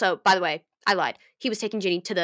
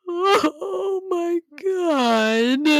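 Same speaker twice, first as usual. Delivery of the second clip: sobbing; 12 semitones higher, 7 dB louder, much slower than usual. Real, not acted. Overcome reading the excerpt.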